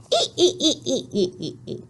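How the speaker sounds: giggled "ee-ee-ee" falling away; high giggle. Shown as a demonstration. Teacher finishing the happy Y chant, high giggle. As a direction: giggle on repeated long E sounds.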